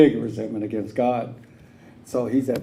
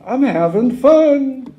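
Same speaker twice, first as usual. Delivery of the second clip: loud, high, slow mock delight; hammed-up high glee, drawn out; gleeful; 12 semitones higher, 10 dB louder, much slower than usual. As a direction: crow with delight after the slap. Laughing at being slapped.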